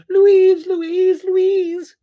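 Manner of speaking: a very loud, very high panicked scream of a name; panicked shouting. Acted. Prue screaming for her sister after the cat lands in her lap, panicked shout. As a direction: scream for help in full panic.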